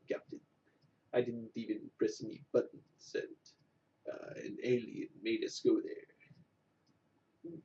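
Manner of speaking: halting, half-whispered spoof of Sulu reporting to the captain; Sulu impression. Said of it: "maybe do an impression or two here".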